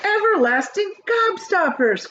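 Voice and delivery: high, loud proud announcement; proud cry. A narrator's reading. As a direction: show off your best invention. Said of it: "cried Mister Wonka proudly".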